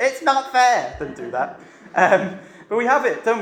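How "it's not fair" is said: put-on high whiny kid voice; whiny child. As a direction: whine it like a sulky child. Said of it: "in a really whiny voice".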